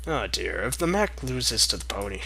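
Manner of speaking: ordinary delivery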